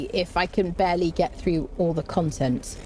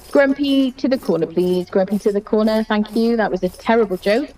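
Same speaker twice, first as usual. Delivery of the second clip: loud, stern mock order; mock telling-off; 6 dB louder than usual. Streamer sending Grumpy to the corner for a terrible joke, mock telling-off. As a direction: order them off with playful severity.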